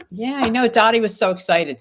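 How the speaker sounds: excited, sharing a viewer's win. Real, not acted. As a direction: gush with excitement for her.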